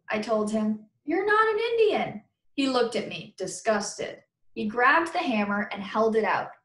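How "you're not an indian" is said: retort. Acted. Narrator retorts to friend.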